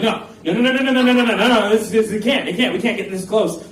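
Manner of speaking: high, loud rapid-fire refusals; retold panic. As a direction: act out spiralling panic, words tumbling over each other. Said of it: "And I am panicking".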